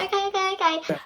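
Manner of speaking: high, soft frightened okays; timid okays. Retold or imitated. Frightened pupil meekly obeys the teacher she fears, high and soft. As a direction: give in meekly to the scary teacher. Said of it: "I was terrified of her".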